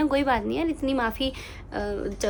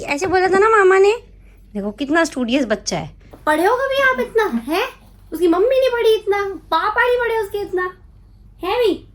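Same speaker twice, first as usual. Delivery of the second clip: high, teasing sing-song voice; 8 semitones higher, 3 dB louder than usual. Real, not acted. Mother teasing her baby about studying, high and playful.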